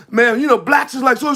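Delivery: loud, animated mock complaint; hammed-up loud griping in imitation. Acting out someone complaining among friends, loud and free. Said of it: "you be loud".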